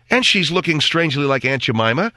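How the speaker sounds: usual speaking voice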